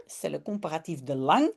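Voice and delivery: usual speaking voice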